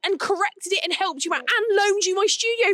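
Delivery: high-pitched, mock-outraged retort; indignant outburst. Confronting the photographer who slagged her off, indignant. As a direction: protest every favour in a high, indignant voice.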